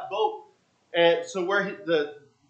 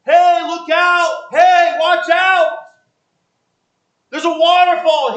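yelling a warning; 11 semitones higher, 12 dB louder, slower than usual. Retold or imitated. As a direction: shout it high, slow and loud. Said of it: "some people are yelling out to him".

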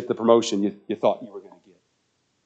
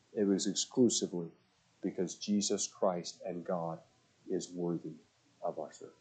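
reverent hush; 4 semitones lower, 9 dB quieter than usual. Real, not acted. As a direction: bring it down reverently. Reverent solemn close.

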